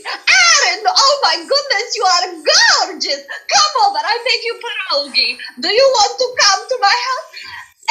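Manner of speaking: put-on high, sing-song Polish accent, doting. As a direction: gush like a warm Polish friend. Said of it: "she talked like this".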